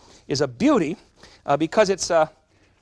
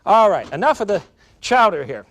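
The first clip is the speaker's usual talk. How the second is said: hollering; 2 semitones higher, 7 dB louder than usual. Retold or imitated. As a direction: shout it like a husband stopping her. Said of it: "He would holler".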